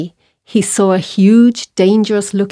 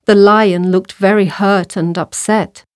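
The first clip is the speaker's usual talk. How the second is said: ordinary delivery, nothing marked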